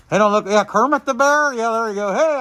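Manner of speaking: usual speaking voice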